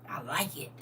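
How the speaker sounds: soft unvoiced whisper; delighted whisper. Real, not acted. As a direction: breathe it like a secret pleasure.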